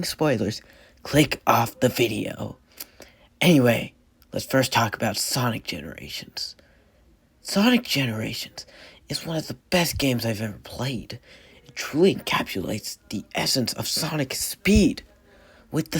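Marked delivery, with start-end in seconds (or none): 4.3-11.2 breathy whisper, voice nearly gone; barely voiced whisper; lost voice whisper. Real, not acted.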